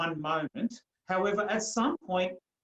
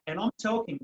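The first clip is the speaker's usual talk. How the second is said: ordinary delivery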